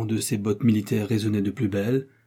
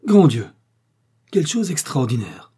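loud, high burst of astonishment; astonished cry; 5 semitones higher, 7 dB louder than usual. A narrator's reading. Captain hearing of the murder, crying out astonished. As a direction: exclaim in shock, then ease off. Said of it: "s'écria le capitaine Fanny".

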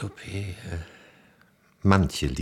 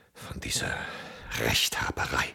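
whisper; 8 semitones lower, 6 dB quieter than usual. Acted. Disdainful remark after long pause.

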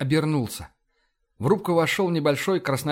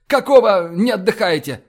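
loud, higher-pitched demand; angry shout; 5 semitones higher, 8 dB louder than usual. A narrator's reading. Fox rousting the resting watch, angry shout. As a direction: yell at the slackers. Fox yelling at idle crew.

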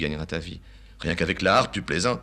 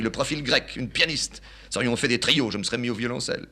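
put-on high, sniffy old man; father running down his son's new love, higher; scornful father; 6 semitones higher, 5 dB louder than usual. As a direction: run down the girl. Father disparaging the girl.

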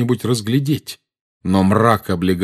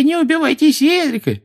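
high, mocking falsetto wail; thin put-on whine; 5 dB louder than usual. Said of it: "запричитал тонким, деланным голоском".

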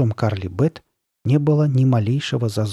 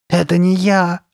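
high, loud cry of horror; horrified wail; 7 semitones higher, 5 dB louder, slower than usual. A narrator's reading. Carly Beth at her reflection, wailing in horror. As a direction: wail it out, pitched up. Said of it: "причитала Карли Бет".

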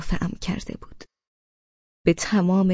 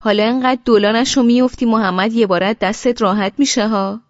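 quick, louder, amused teasing; laughing; 5 semitones higher, 6 dB louder than usual. A narrator's reading. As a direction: toss it off laughing. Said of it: "امیر با خنده گفت".

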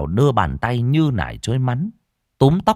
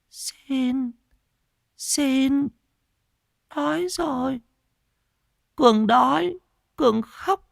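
put-on pleading beggar girl; slower than usual. A narrator's reading. Mad girl holding out her hand to the owner, pitifully begging. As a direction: beg slowly in a tiny high voice.

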